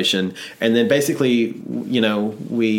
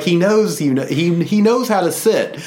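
higher, doting pet owner voice; proud dog owner; 7 semitones higher, 4 dB louder than usual. Retold or imitated. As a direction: excuse the dog fondly. Owner excusing dumb puppy.

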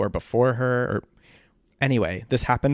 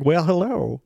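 drawn-out Southern greeting, pitched up; Southern-accented hello with a lifted pitch; 6 semitones higher, slower than usual. Retold or imitated. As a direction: imitate a Southerner saying hello. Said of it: "like, a Southern accent".

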